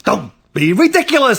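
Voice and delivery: high, indignant brush-off; exasperated parent, pitched high; dismissive scolding. A narrator's reading. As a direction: dismiss it as utter nonsense. Mother scoffs at child's alarm.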